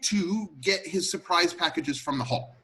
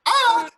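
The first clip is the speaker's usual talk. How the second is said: short, high, loud imitation scream; mock scream; 9 dB louder than usual. Butler re-enacting Missus Peacock's scream, theatrical shriek. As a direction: yelp out her scream at full pitch. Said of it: "She screams".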